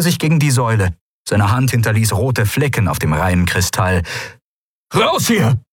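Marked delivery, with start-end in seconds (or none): 4.9-5.6 high, urgent yell; bellowed order. Acted. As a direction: yell it as an emergency evacuation order. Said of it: "brüllte er". (gebrüllter Befehl, hoch und dringend)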